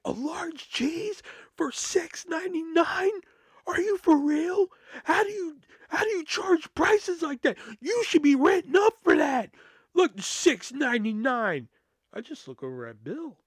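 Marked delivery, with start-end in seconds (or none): 0.1-3.2 very high, drawn-out outraged question; losing her mind. Retold or imitated. Customer hearing the pizza deal, shrieky mock outrage. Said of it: "This woman lost her fucking mind". 3.7-11.7 loud, very high-pitched mock outrage; losing her mind. Customer berating staff over pizza prices, high hammed-up fury. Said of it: "This woman lost her fucking mind".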